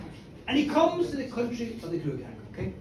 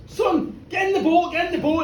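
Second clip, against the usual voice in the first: high, raised-voice fatherly command; urgent order; 6 semitones higher, 5 dB louder than usual. Acted. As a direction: order your boy along, loud and insistent. Father urgently ordering his son.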